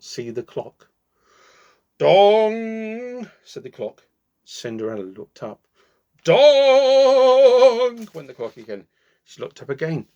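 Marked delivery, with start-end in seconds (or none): 2.0-3.3 long high loud bong; clock chime. A narrator's reading. Clock striking midnight, a long loud bong. 6.2-8.1 long high loud bong; clock chime. A narrator's reading. Clock striking midnight, a long loud bong.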